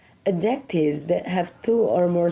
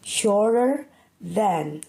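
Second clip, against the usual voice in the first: Shorter than said slowly and carefully; slow repeat; much slower than usual. Shown as a demonstration. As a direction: repeat the key phrase slowly. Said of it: "He is shorter than me".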